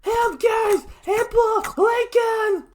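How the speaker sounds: very high, loud cries; calling for help. A narrator's reading. Mabel screams for help from Lincoln. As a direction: yell for rescue.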